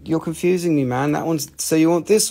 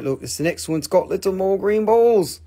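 normal talking voice

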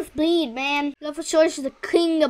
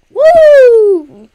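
excited cheer; 6 semitones higher, 14 dB louder than usual. Real, not acted.

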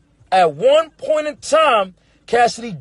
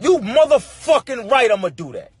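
ordinary talk

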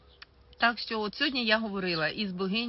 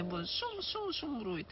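sarcastic mimicry; 5 semitones higher, 7 dB quieter than usual. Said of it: "з таким відвертим сарказмом".

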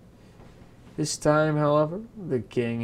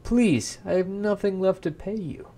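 high, urgent plea; begging; 6 semitones higher, 4 dB louder than usual. Acted. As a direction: plead with the imp, voice raised. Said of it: "begged him to stop".

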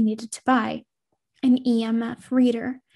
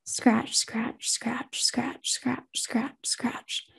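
soft whispered scratching; 8 dB quieter, much slower than usual. Acted. Unseen presence scratching at Casey, whispered. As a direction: breathe the scratches out quietly. Eerie scratching sound in dark room.

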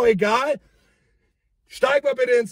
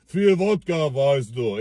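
put-on lower, slurred Russian accent; drunk Russian accent; 10 semitones lower, slower than usual. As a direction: drunk Russian brushing off the police. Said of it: "Mit so einem russischen Akzent". (tiefer betrunkener russischer Akzent)

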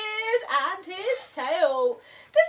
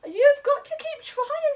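urging; 7 semitones higher than usual. Acted. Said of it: "said Tiger".

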